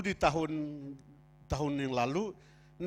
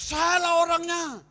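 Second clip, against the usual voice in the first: loud, high, drawn-out yell; slow, full-voiced cry; acted shout; 11 semitones higher, 10 dB louder, slower than usual. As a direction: holler it across the hall like a cattle call. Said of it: "Ya, saya berteriak".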